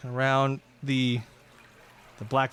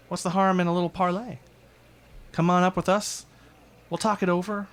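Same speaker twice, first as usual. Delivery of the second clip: high, sweet, louder invitation; coaxing villainess; 7 semitones higher, 3 dB louder than usual. Acted. Viper luring the hiding party to parlay, high and sugary. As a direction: lure him upstairs with false charm.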